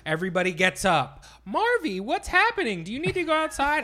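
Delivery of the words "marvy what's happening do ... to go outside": high-pitched imitation of S0; high mocking voice. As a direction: mimic her, way up high. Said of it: "not how I sound".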